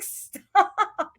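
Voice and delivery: exasperated plea. Retold or imitated. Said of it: "It makes me so mad".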